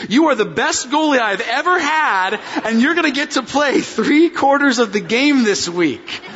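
put-on high, eager bragging kid; excited kid voice. Pastor replaying what son heard, high and thrilled. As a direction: recast it as the boy heard it. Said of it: "hear this again".

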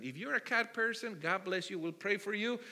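ordinary delivery

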